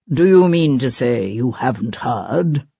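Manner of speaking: a hint of a grand stage actor; deep, rich stage voice. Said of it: "The timbre of his voice was deep and rich".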